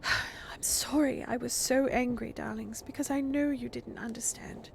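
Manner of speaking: slow, whispered, gentle mother; a mother whispering sorry after tears; whispered apology. Acted.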